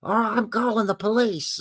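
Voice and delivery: high-pitched mock scolding; hammed-up high nagging threat. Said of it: "getting up in my face and saying".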